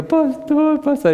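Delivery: very high, drawn-out squeal; high-pitched mock wail; imitating emotional wife. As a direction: mimic an overcome woman calling out in falsetto. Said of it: "Y empezó a llorar".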